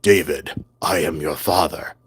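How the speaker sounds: deep Darth Vader impression. Shown as a demonstration. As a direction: drop right down low, like Darth Vader. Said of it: "like Darth Vader".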